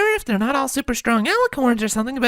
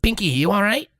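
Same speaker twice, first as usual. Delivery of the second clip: ordinary delivery, nothing marked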